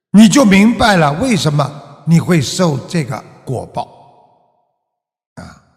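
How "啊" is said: normal talking voice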